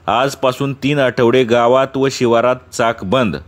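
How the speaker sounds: ordinary delivery, nothing marked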